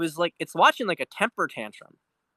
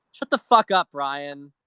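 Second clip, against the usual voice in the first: angry shout; 5 dB louder, slower than usual. Real, not acted. Streamer yells at a TV host he despises, furious shout. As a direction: blow up at him, full volume.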